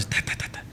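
soft imitated door knocking; quick quiet mouth-made knocks; golpes imitados. (golpecitos de puerta imitados, suaves)

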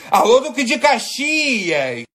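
drawn-out announcer greeting; slow, showy hello to the crowd; shout-out. Real, not acted. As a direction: hype shout-out to the neighborhood. Shout-out to the neighborhood.

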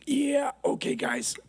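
whispery, just-woken murmur; groggy, unbothered reply. Acted. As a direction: play someone calmly roused from sleep. Sleeping Jesus roused by panicking disciples.

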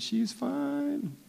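high, very slow appreciative aside; admiring drawl. Retold or imitated. Rumor praising the king's daughter, slow and high. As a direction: stretch it out admiringly.

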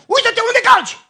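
very high, loud snap at a stranger; angry yell. Retold or imitated. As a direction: yell at the man on the bus who stepped on him. Strigă la cel care-l calcă. (răcnet furios, foarte sus și tare)

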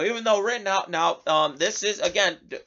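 ordinary talk

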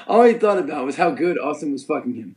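normal talking voice

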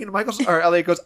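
ordinary delivery

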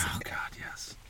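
breathy reverent whisper. Real, not acted. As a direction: barely whisper it. Whispered reply.

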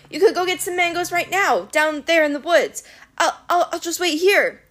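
high, coaxing and nervous; pleading Grover. A narrator's reading. Grover sending the monster away, high nervous coaxing.

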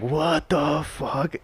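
slow, high, stunned; disbelief. Retold or imitated. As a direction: drag it out in disbelief. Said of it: "And you're like".

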